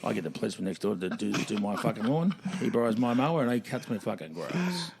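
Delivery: ordinary talk, nothing marked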